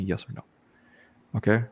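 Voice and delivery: ordinary delivery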